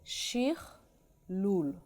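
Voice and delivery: Shir lul slowly, split into separate syllables; slow teaching pronunciation of Shir lul. Shown as a demonstration. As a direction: pause between Shir and lul for a learner. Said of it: "Now I'm gonna say it slowly".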